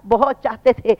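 ordinary delivery

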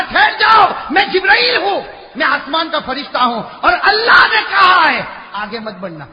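high, loud angelic command. Acted. Gabriel ordering the grandfather to stop, commanding shout. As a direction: halt him with heavenly authority.

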